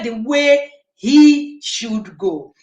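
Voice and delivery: slow, emphatic stress on "he should go". Retold or imitated. Panellist retelling an emphatic speaker's point on scripture, emphatic. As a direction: lean on "he", drawing out each word slowly. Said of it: "he was very emphatic".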